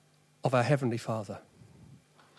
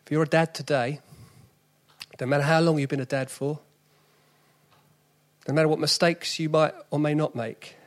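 ordinary talk

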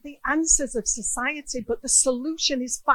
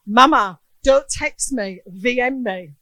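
son's reply; 12 dB louder than usual. Retold or imitated. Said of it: "the first response was".

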